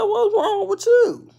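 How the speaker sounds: high, loud, slow call. Retold or imitated. Calling to the slumped lady, high and loud. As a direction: call her again with rising alarm. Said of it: "I said".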